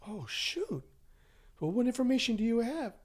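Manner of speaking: high-pitched, startled reaction; retold surprise. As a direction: act out a friend taken aback and curious. Friend reacts to adoption news.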